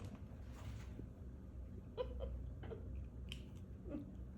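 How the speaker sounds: soft laugh. Real, not acted. Laughs after finishing.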